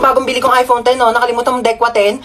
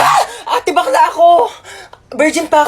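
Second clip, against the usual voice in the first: mock panic; 6 semitones higher than usual. Driver panics at robbery claim.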